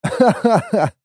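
amused, high laugh; hearty laugh. A narrator's reading. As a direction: laugh at a companion's panic. Hero laughs at Ken's dread. (смех над паникой спутника)

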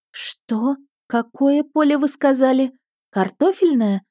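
dismayed, asking again; a sinking voice, hoping she misheard; Картофельное asked with dread; crestfallen. A narrator's reading. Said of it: "упавшим голосом переспросила я".

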